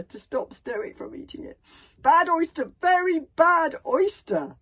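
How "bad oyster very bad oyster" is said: loud, high, stretched-out cry; gulped warning. A narrator's reading. Bodyguard has swallowed the bad oyster, gulping out a warning. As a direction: blurt it loudly, dragging the last words. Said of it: "he gulped".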